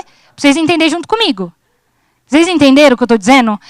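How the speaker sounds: ordinary delivery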